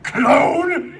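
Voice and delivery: a high, loud laughing cry of disbelief; cracking up over the word clone. Real, not acted. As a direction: burst out laughing at the twist. Laughing reaction to the twist.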